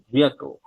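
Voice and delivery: vehicle as "VEE-a-ko"; model pronunciation. Shown as a demonstration. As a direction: say vehicle for learners to copy, no h. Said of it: "সবাই একটু practice করুন".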